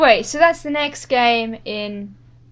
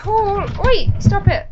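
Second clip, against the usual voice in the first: high, pleading call; 10 semitones higher, 4 dB louder, slower than usual. Real, not acted. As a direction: beg him to come back. Pleading with friend leaving.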